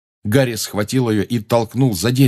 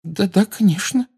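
high, strained little voice; squeezed-out assent; 12 semitones higher than usual. A narrator's reading. Hermione nervously agreeing to her nickname, squeezing it out. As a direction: barely force the agreement out. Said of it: "кое-как выдавила Гермиона".

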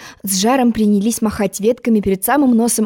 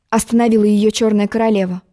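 ordinary delivery, nothing marked

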